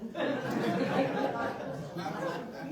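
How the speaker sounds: a loud burst of laughter. Real, not acted. To the council after Jeff's quip, laughing hard.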